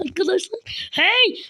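normal talking voice

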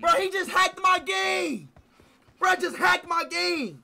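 high, slow, drawn-out shout; wailing gamer outcry; amazed yell. Real, not acted. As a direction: stretch it out in disbelief. Mock-outraged at teammate's trick.

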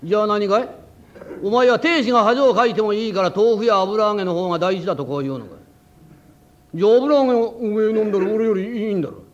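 大きな声ですねて怒る亭主; むきになって女房に食ってかかる; 食ってかかる. Acted. 亭主が女房に食ってかかる. (大きな声でむきになって怒る亭主)

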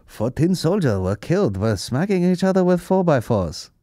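high, swooping put-on Korean accent; hammed-up Korean-accented news report; mock Korean accent. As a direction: parody a North Korean announcer's accent.

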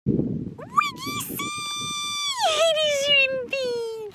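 very high, slow excited squeal. Real, not acted. Owner greeting her dog, high delighted squeal. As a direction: shriek with delight at the pup.

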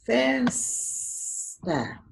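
"Festa" with "Fes" held long and high, then "ta" dropped; ударный слог. Shown as a demonstration. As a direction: stretch the strong "Fes" in "Festa". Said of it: "da sílaba mais forte".

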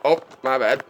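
ordinary talk, nothing marked

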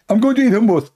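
high-pitched, irritated put-on voice; mock-annoyed, high telling-off; Allah. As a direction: scold the caller in a high comic voice. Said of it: "Allah, he answered".

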